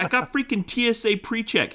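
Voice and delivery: high-pitched indignant exasperation; exasperated protest. Retold or imitated. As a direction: protest in disbelief. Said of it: "And I'm like".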